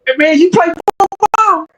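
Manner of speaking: lightly voiced cocky big guy; imitating the big guy. Big guy challenges narrator at football tryout, cocky. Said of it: "He was like".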